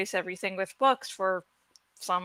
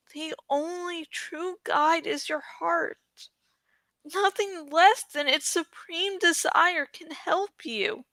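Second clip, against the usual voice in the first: steady him with calm wisdom; wise Saphira; 6 semitones higher, 6 dB quieter than usual. Acted. Dragon gently counselling grieving Eragon, soft wise voice.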